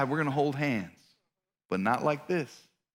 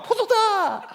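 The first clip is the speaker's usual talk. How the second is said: high, showy held cry; mock gymnast's flourish, pitched way up; triumphant ta-da. Boy landing a boasted backflip, mock triumphant.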